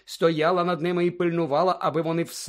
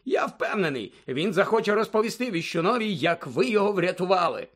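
higher-pitched, eager official; self-satisfied official; 5 semitones higher than usual. Acted. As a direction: gloat in a raised, lively voice. Fudge speaking to Snape. (самовдоволений чиновник, високим голосом)

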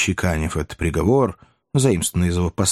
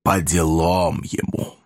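slow, hollow and sinister; hollow ominous voice; 2 semitones lower, slower than usual. A narrator's reading. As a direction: mutter it like a grim verdict. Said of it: "глухим, зловещим голосом".

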